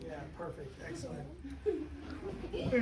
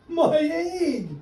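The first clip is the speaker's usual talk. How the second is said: high loud cry; shouted; 9 semitones higher, 8 dB louder than usual. Acted. Horton as his egg hatches, loud shout. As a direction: cry out in joyful alarm. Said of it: "shouted Horton".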